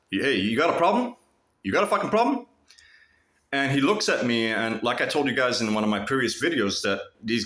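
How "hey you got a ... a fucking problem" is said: rising challenge, pushing harder; confronting. Retold or imitated. Vlogger confronting the stalker, challenging him angrily. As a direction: square up and demand an answer. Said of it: "looked him in the eyes and said".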